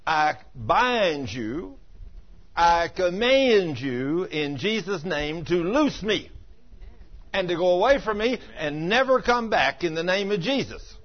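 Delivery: slow, loud, high binding command; preacher modelling a slow, loud rebuke of pain; commanding prayer. Shown as a demonstration. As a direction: rebuke the pain word by word with authority. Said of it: "speak to it".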